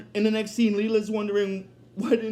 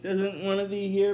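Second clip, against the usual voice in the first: ordinary talk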